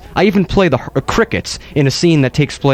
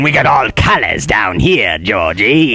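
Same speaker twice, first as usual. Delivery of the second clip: low, creepy clown voice; a lightly voiced Tim Curry Pennywise; Pennywise impression; 6 semitones lower, slower than usual. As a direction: coax the kid like a sinister clown. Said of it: "pretty fantastic impression".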